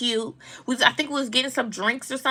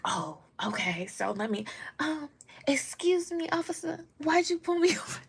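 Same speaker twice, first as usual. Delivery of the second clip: very soft, polite put-on professional voice; hushed, high-pitched politeness for authority, cracking into a laugh; corporate voice; 5 semitones higher, 12 dB quieter than usual. Driver asking officer why he stopped her, corporate voice. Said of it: "let me put my corporate voice on".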